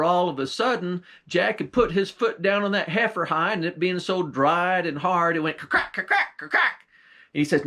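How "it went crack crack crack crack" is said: high snapping crack sounds; cracking noise. Acted. Jack steps on the dry hide, crackling sound. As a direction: make the hide crack sharply.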